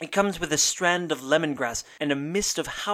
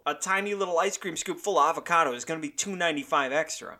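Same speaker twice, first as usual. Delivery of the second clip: usual speaking voice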